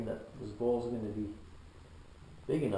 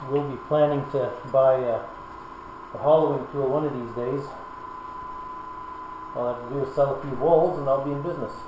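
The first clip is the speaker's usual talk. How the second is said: loud; 5 dB louder than usual. Real, not acted. Talking over a running lathe.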